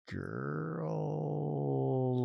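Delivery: put-on long, soft growl on "Girl". Shown as a demonstration. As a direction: pull "Girl" out into a quiet growl. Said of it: "it's a growl to it".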